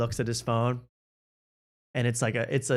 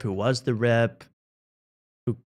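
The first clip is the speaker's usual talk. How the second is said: ordinary talk, nothing marked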